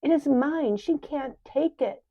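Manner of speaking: high, loud cry; cried out. Acted. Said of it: "cried Faith".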